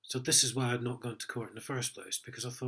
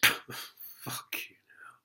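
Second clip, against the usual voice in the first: whispered curse; much slower than usual. Real, not acted. Muttered aside after the story.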